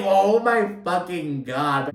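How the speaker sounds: high, drawn-out mock shock; amused disbelief. Podcaster retelling reaction to Jabba insult, scandalised delight. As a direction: stretch it out, reeling with amusement.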